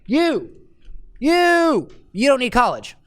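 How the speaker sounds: high, loud pushy insistence; barking at them. Shown as a demonstration. Coach mocks pushy advice to a reader, loud high bark. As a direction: jab each you like a finger poke. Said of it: "But if I say".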